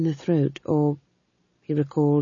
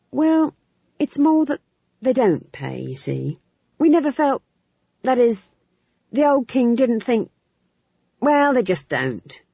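flustered chamberlain; 8 semitones higher, 4 dB louder than usual. A narrator's reading.

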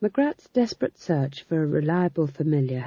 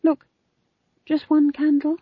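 high, louder pleading; 13 semitones higher, 5 dB louder than usual. A narrator's reading. Young witch pleading with the elders for a candle. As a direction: beg for just one candle. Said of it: "a look of pleading".

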